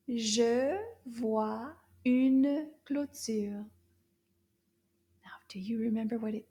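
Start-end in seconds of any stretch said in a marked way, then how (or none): 0.1-3.7 very slow, a bit louder; slow repeat. Shown as a demonstration. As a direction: repeat it slowly for them.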